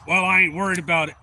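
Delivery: loud mock comeback; imitated defiance. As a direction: play the stranger arguing back, loudly. Retold stranger argues back.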